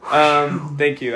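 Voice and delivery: ordinary talk, nothing marked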